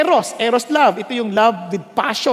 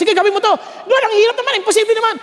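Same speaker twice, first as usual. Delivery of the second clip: acted dialogue; 10 semitones higher, 3 dB louder, much faster than usual. Voicing Lord and complaining believer.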